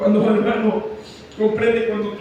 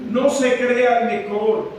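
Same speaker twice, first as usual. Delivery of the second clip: fervent preaching; 4 semitones higher than usual. Acted. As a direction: pound the verses home to the congregation. Preaches at full force.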